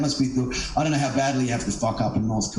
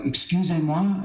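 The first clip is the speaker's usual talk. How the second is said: French; 6 semitones higher than usual. Retold or imitated. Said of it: "she was French".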